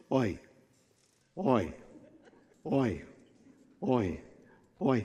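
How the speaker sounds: low, soft oi on each step; evenly spaced weary groans under a load; groaning log carriers. Acted. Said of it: "as they carry them down".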